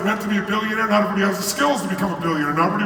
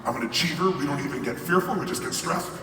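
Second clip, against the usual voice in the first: low, soft inner protest; 5 semitones lower, 5 dB quieter than usual. Retold or imitated. Inner voice denying he suffers, low and soft. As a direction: mutter the denial to yourself, low. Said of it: "something inside me is like".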